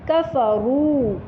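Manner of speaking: कफरू with the final रू drawn out long; long vowel at the end. Shown as a demonstration. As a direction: stretch the last vowel of कफरू slowly. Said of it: "ये थोड़ा लंबा करके पढ़ेंगे".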